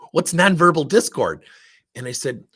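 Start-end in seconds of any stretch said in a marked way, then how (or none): 0.1-1.5 louder, higher mock disbelief; baffled comeback, punched up loud; retold incredulous question. Vice president questioning the consultant's odd tally, incredulous.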